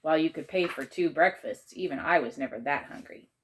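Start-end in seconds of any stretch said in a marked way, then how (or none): none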